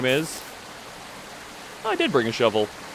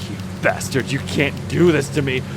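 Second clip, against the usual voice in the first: furious killer; 3 semitones higher, 6 dB louder than usual. Acted. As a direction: spit it out, betrayed and furious.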